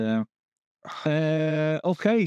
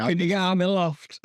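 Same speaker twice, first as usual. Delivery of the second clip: mock plea; 5 semitones higher than usual. Mimics co-host pleading with bird.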